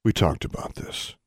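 quiet, restrained reminder; said quietly. A narrator's reading. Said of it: "she said quietly".

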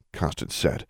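plain reading voice

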